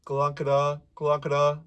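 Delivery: "glockada" said with a loose hanging jaw, only the tongue moving; tongue only, jaw dropped. Shown as a demonstration. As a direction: let the jaw hang and flick out "glockada" with the tongue. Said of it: "If I let my jaw drop and I only say this with my tongue".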